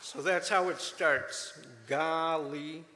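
"Galicia" said slowly and loud, like "Golly" at the start; pronunciation lesson. Shown as a demonstration. Teaching the church how to say "Galicia" clearly. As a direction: sound out "Galicia" for the class. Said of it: "I'm gonna give you a little lesson".

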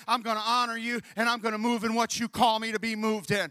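loud, high revival shouting; preaching at full force. Acted. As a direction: whip the congregation up with fire. Altar call preaching.

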